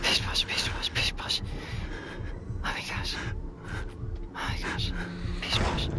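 soft whispered pish posh, slowed down; nervous whisper. Real, not acted. Player hiding from the doctor, nervous whispered pish posh. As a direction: whisper it to calm yourself.

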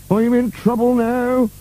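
slow, very high character voice; dismayed servant. A narrator's reading. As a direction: wail slowly at the trouble coming. Burke dismayed at lost eyeball.

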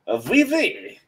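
high mock-French squeal; bad French accent. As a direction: attempt French and miss badly. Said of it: "wouldn't be able to maintain a French accent".

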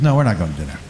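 put-on a deep, flat older woman; deep-voiced woman shutting it down; low firm refusal.

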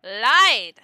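one loud, punched word; playful shout. Real, not acted. Player owning up to lying, loud playful shout. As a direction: belt it out as a brazen admission.

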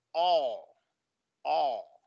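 "o" as long open aw; open-throat sound. Shown as a demonstration. Teacher imitating doctor's exam, open-mouthed vowel. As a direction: say "o" as for a throat check. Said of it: "como cuando el doctor te dice".

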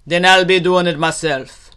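loud, firm retort; resolute gator. Acted. Gator will fill the pot himself, loud retort. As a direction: declare it forcefully to the refusers.

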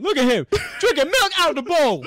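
high, loud comic yelling, breaking into laughter; hammed-up shout with a laugh; Pootie Tang riff. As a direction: holler it in gleeful mock disbelief. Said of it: "I feel like Pootie Tang".

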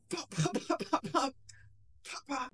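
rapid high soft pops; mock rifle fire, quiet and high; imitated gunfire. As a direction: fire off shots with your mouth. Imitating his rifle firing.

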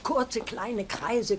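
slow, instructive "Kurze, kleine Kreise"; patient slow teaching voice; slow demonstration. Shown as a demonstration. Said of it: "so doch nicht".

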